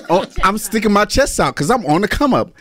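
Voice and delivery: high-pitched, energetic boast. Shown as a demonstration. Guest answers DJ's call for energy, hyped. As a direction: pump it up with energy. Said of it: "I need some energy".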